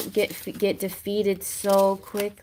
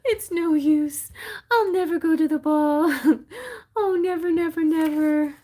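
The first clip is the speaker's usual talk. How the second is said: hammed-up sobbing, loud and high; mock crying; 9 semitones higher, 4 dB louder than usual. Cinderella in the garden despairing of the ball, mock crying. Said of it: "starts crying".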